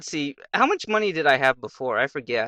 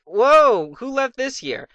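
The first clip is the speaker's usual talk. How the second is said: high, loud drawn-out whoa; acted astonishment, pitch leaping up; amazed discovery; 8 semitones higher, 4 dB louder, slower than usual. Boy pulls out a sack of gold, amazed.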